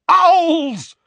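high, loud furious bellow. Acted. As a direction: bellow it in rage. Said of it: "bellowed Uncle Vernon".

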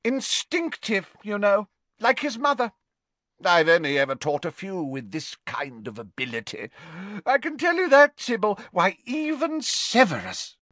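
put-on high, hearty old professor voice; a fond, tipsy potions master; jovial Slughorn. Tipsy teacher praising Harry to Trelawney, high and hearty.

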